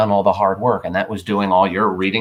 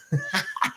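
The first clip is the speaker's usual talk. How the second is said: quick high giggle; a light laugh at the joke; brief amused laugh; short laugh; 11 semitones higher, 8 dB quieter than usual. Real, not acted. Laughs at own example.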